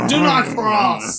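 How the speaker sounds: bridge troll. Retold or imitated. Friend mocks drunk buddy as bridge troll, slow mock troll voice. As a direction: drag it out like a guarding troll. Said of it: "you became the bridge troll".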